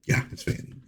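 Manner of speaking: breathy hushed confirmation under the breath; whispered aside. Real, not acted. Reviewer whispering the corrected name to himself, hushed. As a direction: whisper it to yourself.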